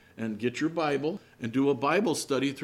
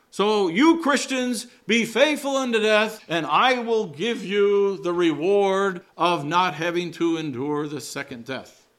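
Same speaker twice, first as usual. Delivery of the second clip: mock promise voice; 5 semitones higher, 5 dB louder than usual. Voicing the commentators' reading.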